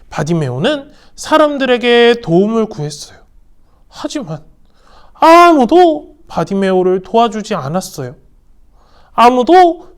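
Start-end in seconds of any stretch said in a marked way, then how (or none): none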